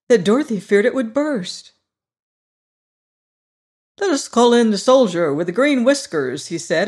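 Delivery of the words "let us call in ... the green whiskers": higher, louder cheerful straw man. Acted. Scarecrow boasting to his friends, high and loud. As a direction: play the proud new ruler, brighter and up.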